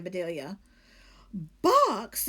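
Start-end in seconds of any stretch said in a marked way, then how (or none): 1.6-2.3 loud, high shocked cry; exclaiming in shock. Acted. Lady hearing of the chicken box, loud shocked cry. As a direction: blurt it out in alarm. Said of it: "exclaimed Missus Rogers".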